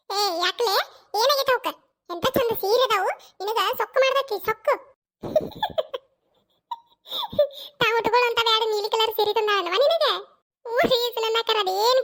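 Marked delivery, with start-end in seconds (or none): 5.2-6.0 high laugh. Acted. Teasing laughter between women.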